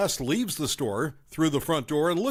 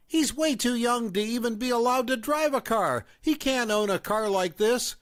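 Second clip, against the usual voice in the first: higher-pitched accusing mother; raised mom voice; 8 semitones higher than usual. A narrator's reading. Mom confronting the narrator at the fast food place, accusing. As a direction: play the suspicious mom, pitched up.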